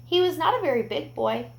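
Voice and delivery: usual speaking voice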